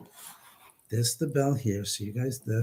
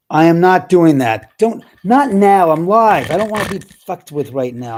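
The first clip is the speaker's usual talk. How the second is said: loud, higher, irritated protest easing off at the end; angry refusal; 5 semitones higher, 12 dB louder than usual. Real, not acted. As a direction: tell them off loudly, fed up. Scolding the spirits interfering live.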